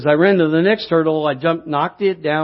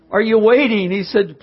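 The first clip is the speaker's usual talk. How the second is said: ordinary talk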